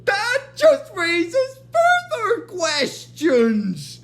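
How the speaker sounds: high, loud, very slow cartoon voice; hammed-up high-pitched declaration; Hermes. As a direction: imitate a character proclaiming each word, drawn out. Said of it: "Hermes is like".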